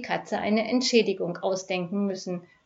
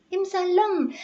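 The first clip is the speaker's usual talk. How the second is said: "Im Salon" piped much higher in falsetto; falsetto squeak; 10 semitones higher, 3 dB louder than usual. A narrator's reading. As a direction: squeak "Im Salon" like a thin-voiced crook. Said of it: "piepste die Fistelstimme". ("Im Salon" hoch und piepsig in Fistelstimme)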